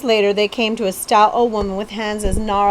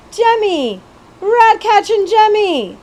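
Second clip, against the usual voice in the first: much higher, loud squeaky call across a crowd; great squeaky voice; 11 semitones higher, 6 dB louder, slower than usual. Acted. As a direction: squeak his name out over the hubbub, slowing on the second call. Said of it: "a great squeaky voice".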